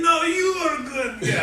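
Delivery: put-on Russian accent, pushing the compliment back. Drunk Russian friend insisting back, in a Russian accent. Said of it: "I do a, a neat accent".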